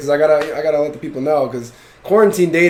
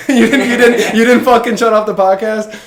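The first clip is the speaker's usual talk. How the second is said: higher and louder, laughing mid-sentence; 7 semitones higher, 5 dB louder than usual. Real, not acted. Host laughing as he thanks listeners for staying, laughing. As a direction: crack up while teasing the listener.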